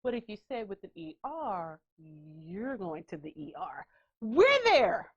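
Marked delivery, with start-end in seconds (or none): 0.0-3.8 slightly hushed, low mock caution; lowered quoted warning. Our generation laying down the rule, lowered warning. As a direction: drop low and soft for the warning.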